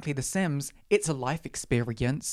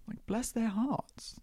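hushed mock mourning; 6 semitones higher, 8 dB quieter, slower than usual. Mourning deleted Sims.

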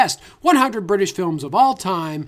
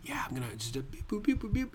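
whispered beeps; 13 dB quieter, faster than usual. Acted. Calling security on intercom.

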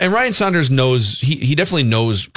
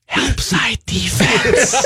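frantic whisper; 8 semitones higher, much slower than usual. Pretend. Whisperer behind the player.

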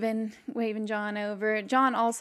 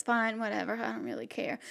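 indifferent sheriff; 8 dB quieter, faster than usual. Acted. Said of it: "he says".

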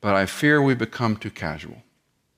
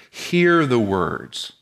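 slow emphatic imperative; much slower than usual. Shown as a demonstration. Preacher spells out the imperatives slowly.

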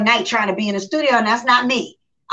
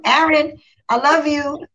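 higher, louder sing-out of affection; acted affectionate call; 6 semitones higher, 4 dB louder, slower than usual. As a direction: greet him in a raised, playful voice. Acting out her calls to him.

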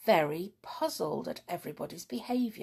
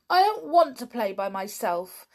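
almost in tears; 5 semitones higher, 8 dB louder than usual. A narrator's reading. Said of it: "almost in tears".

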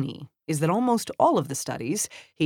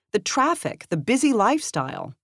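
higher-pitched, mildly blurted reply; narrated blurt, pitched up; 7 semitones higher, 4 dB louder than usual. A narrator's reading. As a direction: lightly voice a child blurting an answer. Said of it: "the eight-year-old blurted".

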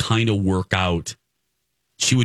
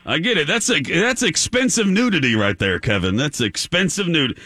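put-on manly caller, pitched up; 9 semitones higher than usual. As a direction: play an eager prank caller in a raised manly voice. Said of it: "that was my manly voice".

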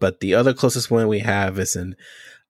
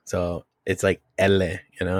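usual speaking voice

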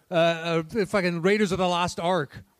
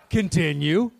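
usual speaking voice

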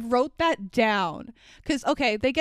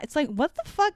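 normal talking voice

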